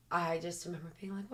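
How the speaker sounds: usual speaking voice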